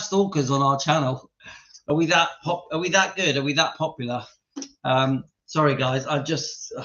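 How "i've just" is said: words collapsing into real laughter; can't stop laughing. Real, not acted. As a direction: try to explain, then crack up. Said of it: "I've had such a laugh tonight".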